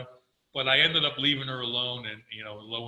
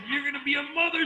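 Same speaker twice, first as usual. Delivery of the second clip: much higher, louder shocked question; stunned question; 14 semitones higher, 5 dB louder than usual. Retold or imitated. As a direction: disbelief at the news, up high. Sees the pregnancy test stick.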